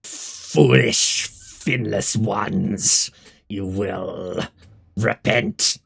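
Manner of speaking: slow, drawn-out villainous hiss; hissing creature. Acted. Game master voices a fleeing monster threatening heroes, slow hiss. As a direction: hiss each word out like a vengeful sea monster. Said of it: "he's gonna hiss at you".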